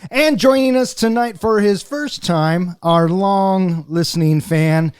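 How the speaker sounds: ordinary talk, nothing marked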